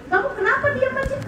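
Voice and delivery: high, quick, softly voiced mock annoyance; mildly mimicked spousal irritation; retold angry husband. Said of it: "Dia semakin marah".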